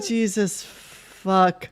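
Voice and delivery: usual speaking voice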